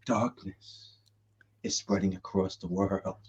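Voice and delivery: low, hushed voice of doom; grave, near-whispered prophecy; [name]. Shown as a demonstration. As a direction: speak very softly and deep, like an ominous narrator. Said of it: "I just did the voice".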